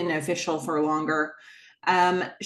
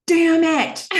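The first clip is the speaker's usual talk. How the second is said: loud, high mock outburst; mock exasperation; 10 semitones higher, 9 dB louder than usual. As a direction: curse in imitated frustration. Imitates Gordon's frustrated outburst.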